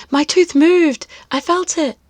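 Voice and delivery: high, bubbling with news; excited. Acted. Boy telling teacher his tooth moved, excited. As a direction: squeal it like big news.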